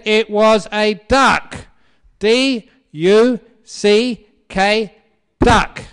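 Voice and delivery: very loud, high, slow shouting; hammed-up furious husband. Husband spelling it out to his stubborn wife, exasperated shout. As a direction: shout it out letter by letter.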